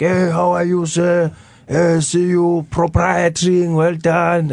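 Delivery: high, slow mock-English greeting; grandpa's broken English. Grandfather greeting people in the township, high and slow. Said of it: "He'd just run around and be like".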